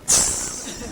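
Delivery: short mock hiss of annoyance; angry hiss. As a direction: imitate an angry wife's 'tss'. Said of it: "really angry with me, she goes".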